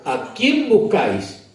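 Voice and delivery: high, slow and loud, like a voice of authority; commanding question. Acted. Jesus asks the arresting mob, slow commanding call. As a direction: ask it slowly and raise your voice.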